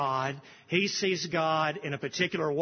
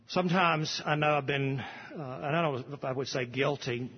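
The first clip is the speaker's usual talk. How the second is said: usual speaking voice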